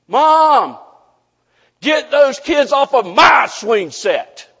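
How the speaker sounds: high, loud, slow shout for mom; furious yell. Retold or imitated. Jealous boy yelling to his mom, furious shout. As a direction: yell it out like an angry child. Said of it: "Sorry if that hurt your ears".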